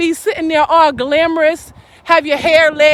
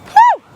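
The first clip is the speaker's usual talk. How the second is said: high whew; 6 dB louder than usual. Real, not acted. Preacher's exclamation.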